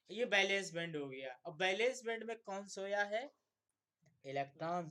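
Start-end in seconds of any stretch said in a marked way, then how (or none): 1.4-3.2 higher and louder, pressing for the answer; loud quiz question. Real, not acted. Teacher to students, demanding the answer loudly. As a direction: call the question out to the whole class.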